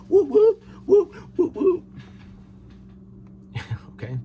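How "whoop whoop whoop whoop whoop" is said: high, loud mocking whoops; party whoops. Mocking the fun-loving states with loud whoops.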